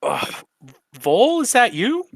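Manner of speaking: pained groan, then high surprised question; groan, then pitch up in recognition; injured frog's groan. Acted. Injured Dolly spots an old coworker, pained surprise. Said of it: "She goes".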